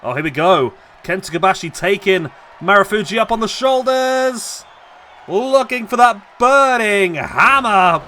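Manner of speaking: loud rising call of the big finisher; excited shout. Acted. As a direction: shout it as the crowd erupts. Finisher building, commentator shouts.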